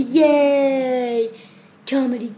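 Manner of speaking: ordinary talk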